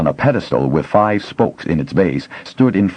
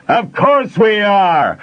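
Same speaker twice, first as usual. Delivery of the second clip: much higher, loud and slow shout; bellowed reply; 4 dB louder, much slower than usual. A narrator's reading. Smith shouting back to the miner, bellowing. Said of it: "Fanderel bellowed back".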